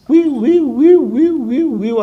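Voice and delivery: high-pitched wee-woo siren; imitated siren wail. Preacher shows authorities rushing over within five minutes, high imitated siren. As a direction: wail like an emergency siren, falling away at the end.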